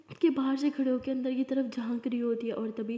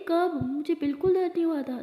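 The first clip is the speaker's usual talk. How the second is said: higher, louder disbelief; surprised denial; 5 semitones higher, 5 dB louder than usual. Acted. Daughter telling her father she felt no pain, surprised. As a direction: protest in disbelief.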